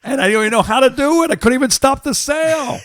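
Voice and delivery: laughing while talking, high and loud. Real, not acted. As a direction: crack up mid-sentence. Laughing at his failed ad.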